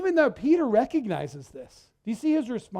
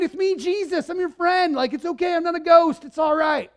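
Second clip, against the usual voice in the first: high-pitched chummy buddy voice; hammed-up friendly reassurance, pitched up; mock casual Jesus; 7 semitones higher, 3 dB louder than usual. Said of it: "That is not what he is doing".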